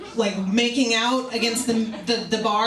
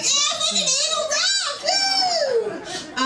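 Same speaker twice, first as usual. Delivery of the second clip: put-on very high, whooping drunk-girl cheer; the wasted fan whooping from the back. Drunk girl in the back cheering, very high whoop. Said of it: "She's like".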